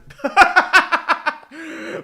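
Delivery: high loud laugh in disbelief; helpless fan laughter; exasperated laugh. Real, not acted. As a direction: laugh at the wreck of a game. Laughs at the disaster.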